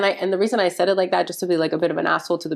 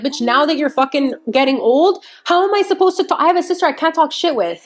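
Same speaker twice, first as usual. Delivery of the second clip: exasperated mock rant; 6 semitones higher than usual. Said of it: "I'm like".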